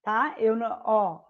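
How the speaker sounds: normal talking voice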